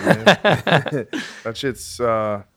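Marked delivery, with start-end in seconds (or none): 0.3-1.4 real burst of laughter. Real, not acted. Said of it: "I'm getting, getting choked up".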